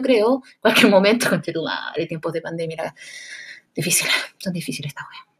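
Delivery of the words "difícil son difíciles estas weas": unvoiced hush; low whispered complaint. Real, not acted. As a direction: whisper it to yourself.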